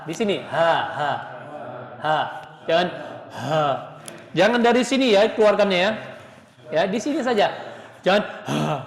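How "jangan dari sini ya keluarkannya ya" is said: higher, overforced ha pushed out too hard; exaggerated ha. Shown as a demonstration. Teacher exaggerates the ha to show students what to avoid. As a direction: demo the overdone ha. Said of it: "terlalu berlebihan".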